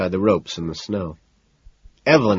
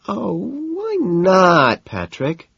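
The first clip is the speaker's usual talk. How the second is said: high, slow whining plea; whiny woman; 11 semitones higher, much slower than usual. A narrator's reading. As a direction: whine it like a spoiled girlfriend. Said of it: "she whines".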